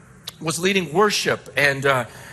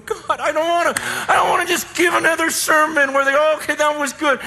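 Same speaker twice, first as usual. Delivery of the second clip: higher, exasperated complaint to God; frustrated prayer; 10 semitones higher, 3 dB louder, faster than usual. Retold or imitated. Pastor complains to God about ordinary sermons, exasperated. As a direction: vent it up high, fed up. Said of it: "frustrated with God".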